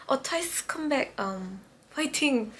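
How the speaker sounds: soft, halting, petering out; small embarrassed voice; awkward cheer. Retold or imitated. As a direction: offer encouragement sheepishly, very softly. Retold awkward cheer to idol.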